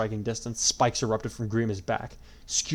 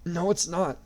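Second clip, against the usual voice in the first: through tears; 8 semitones higher, slower than usual. A narrator's reading. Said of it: "Yui said through tears".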